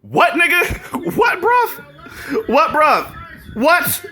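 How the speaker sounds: high-pitched, repeated disbelieving questions; incredulous. Real, not acted. Viewer reacting to voting propaganda, incredulous and high-pitched.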